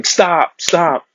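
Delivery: high-pitched mock yell, no real volume; retold police yell. Officer chasing a fleeing man, mild mock yell. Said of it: "Oakland police officer yelling".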